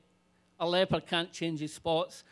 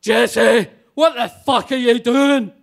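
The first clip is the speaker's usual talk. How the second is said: much louder furious bark; landlord's shout; 4 semitones higher, 14 dB louder, slower than usual. Retold or imitated. Landlord shouting at the drunk woman hitting him. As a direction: roar it at her across the bar. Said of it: "And he shouts".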